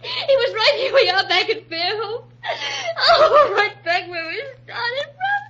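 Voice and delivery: high, half-laughing, half-crying wail; breaking into hysterical crying; hysterical laughing sobs. Acted. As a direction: cry out the irony, laughing through sobs.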